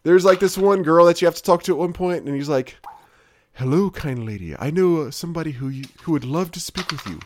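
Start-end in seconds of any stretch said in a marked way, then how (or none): 3.5-7.2 a hint of a gallant hero, overly polite, trailing off; lightly mock a courtly knight's plea; over-romanticized hero. Player mocking the hero asking a girl for help, lightly gallant. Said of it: "is really over-romanticized".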